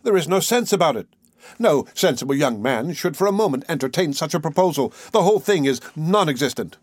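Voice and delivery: usual speaking voice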